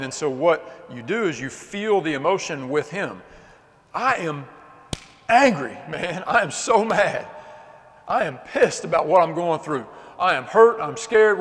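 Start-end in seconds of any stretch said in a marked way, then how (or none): 3.9-11.4 raised, louder cry of hurt and anger; acted anger. Shown as a demonstration. Preacher models telling the Lord his anger, raised angry voice. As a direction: vent it out loud, high and forceful. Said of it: "we also gotta feel the emotion".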